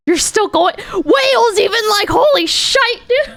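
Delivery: high-pitched, shouted disbelief; incredulous outburst. Real, not acted. Streamer amazed at how long it goes on, high incredulous shout. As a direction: cry out in amazement, pitch climbing.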